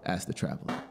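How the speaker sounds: ordinary delivery, nothing marked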